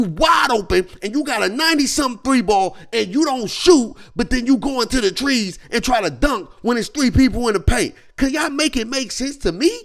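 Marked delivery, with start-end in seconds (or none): none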